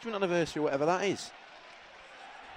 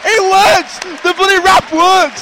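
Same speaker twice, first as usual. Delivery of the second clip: excited shout; 10 dB louder, slower than usual. Real, not acted. Celebrating the goal.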